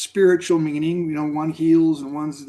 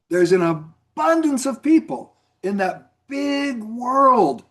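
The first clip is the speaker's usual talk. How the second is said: normal talking voice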